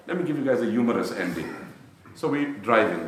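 ordinary delivery

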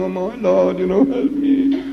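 high, pleading cry to God; a small voiced plea, rising high on help me; tearful inner prayer. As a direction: beg the Lord for help through tears. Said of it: "he's talking, within himself, crying".

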